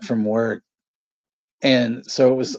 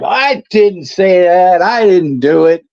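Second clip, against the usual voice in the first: mom denying; 6 semitones higher, 6 dB louder than usual. Retold or imitated. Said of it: "my mom's like".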